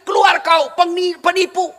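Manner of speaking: loud, high hostile yell; angry mob shout. Acted. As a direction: drive him out with shouts. People of Taif stoning Prophet. (teriakan marah mengusir)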